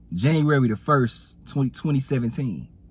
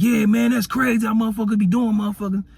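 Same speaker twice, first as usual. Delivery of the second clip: higher, voiced rant about someone; ranting brother; 7 semitones higher than usual. Brother on the phone to his sister, ranting voice. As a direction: play the brother venting on the phone.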